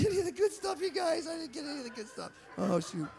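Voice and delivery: real laughter breaking into the words; cracking up at his own joke; laughing. Real, not acted.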